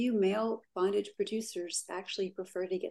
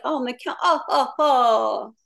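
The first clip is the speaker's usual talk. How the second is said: put-on higher, louder hammy vampire accent; Nyxferatu; 5 semitones higher, 7 dB louder, slower than usual. Vampire character finding two damsels, gleeful ridiculous accent. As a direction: gloat theatrically in a silly vampire accent. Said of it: "ridiculous accent that changes during the clip".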